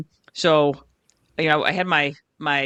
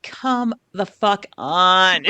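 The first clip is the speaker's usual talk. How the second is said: slow, dragged-out exasperation; exasperated drawl; 2 semitones higher, 4 dB louder, much slower than usual. Retold or imitated. Fan groaning at diving players, slow and exasperated. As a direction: stretch it out in disbelief. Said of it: "I'm like".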